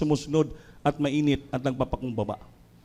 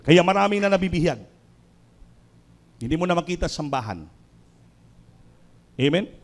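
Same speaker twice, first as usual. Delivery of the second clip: ordinary talk, nothing marked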